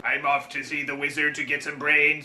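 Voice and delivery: deep, quiet, mechanical drone; low robot voice. Acted. Broken robot answering the kid, deep and quiet. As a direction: drop way down and go soft, like a dying machine.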